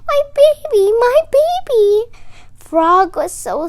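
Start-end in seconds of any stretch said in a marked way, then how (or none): 0.1-2.1 high, loud wailing cry; crying mother bird. A narrator's reading. Mother robin cries over her fallen nest, high wail. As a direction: wail for her lost baby. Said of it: "She was crying".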